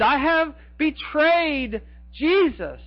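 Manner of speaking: a preacher voicing a repentant Judas's plea; pleading Judas. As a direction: beg for forgiveness as remorseful Judas.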